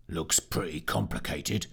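a gruff low growl. Acted. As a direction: grumble it low like a gruff soldier. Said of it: "growled Fitch".

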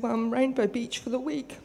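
ordinary talk

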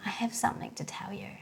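put-on hushed, quick, near-whispered child's confession; imitated timid confession. Tearful daughter coming to confess to mum, near whisper. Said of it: "with her fingers in her mouth".

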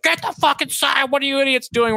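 squeaky hammed-up panic at idiots; mock panic. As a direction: ham up the frantic teammates. Mocking panicked teammates.

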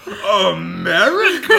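high, slow, drawn-out amazed question; incredulous America. Retold or imitated. Retelling the mechanic's phrase, loud amazed question. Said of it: "I still think of that phrase".

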